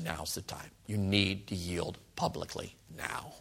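very soft, low and slow; solemn, quiet urging, word by word; hushed inner prompting. Retold or imitated. God prompting a teenager at a service, hushed and solemn.

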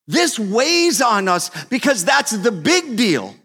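high, loud impassioned preaching; preaching at full force. Acted. Pastor urging the congregation to tell God's story, full-force preaching. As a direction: drive it home to the church.

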